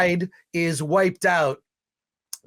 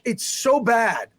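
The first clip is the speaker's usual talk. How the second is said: usual speaking voice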